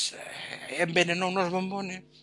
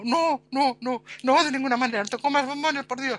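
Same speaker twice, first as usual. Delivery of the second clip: panicked refusal; 6 semitones higher than usual. Retold or imitated. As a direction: stop her in alarm. Panicked refusal of poisoned chocolates. (pánico, más agudo y fuerte)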